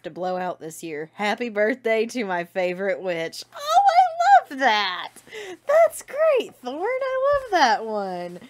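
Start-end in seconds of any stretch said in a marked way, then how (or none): 3.5-8.4 high, loud, slow delighted squeal. Real, not acted. Squealing over a fan's birthday meme, delighted.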